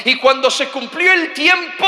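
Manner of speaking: high, loud preacher's cry; fiery preaching. Acted. Preacher to congregation, loud and fiery.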